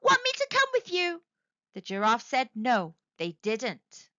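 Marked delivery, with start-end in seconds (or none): 0.0-1.2 high hopeful call after them; lonely dragon calling after the leaving animals; called out hopefully. A narrator's reading. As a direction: lift it high, hoping they say yes. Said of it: "He called out hopefully".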